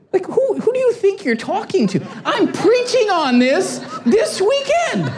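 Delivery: high-pitched, incredulous comic outburst; mock indignation. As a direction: scold your kid in exaggerated disbelief, stretching the last words. Indignant preacher dad answering his son.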